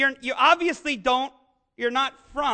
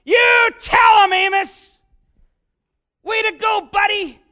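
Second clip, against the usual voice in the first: loud, high cheering shouts from the crowd; 9 semitones higher, 11 dB louder than usual. Retold or imitated. Samaritans cheering Amos on, loud excited shout. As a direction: cheer him on like a rowdy crowd. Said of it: "I can see the people in Samaria going".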